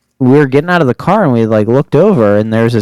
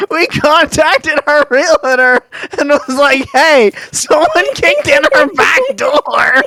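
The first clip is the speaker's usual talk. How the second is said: helpless laughter. Real, not acted. Telling co-host what they told the realtor, cracking up. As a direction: squeeze the words out while cracking up. Cracking up at own story's punchline.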